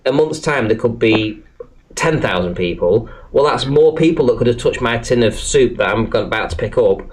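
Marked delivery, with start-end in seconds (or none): none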